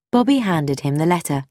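ordinary delivery, nothing marked

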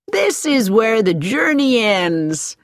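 slow, bright-voiced cheerful announcement; cheerfully. A narrator's reading. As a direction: sound pleased the trip is over, taking your time. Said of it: "said Missus Baker cheerfully".

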